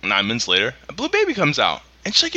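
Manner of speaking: ordinary delivery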